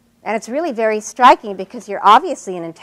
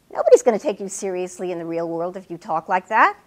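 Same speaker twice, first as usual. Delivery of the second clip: normal talking voice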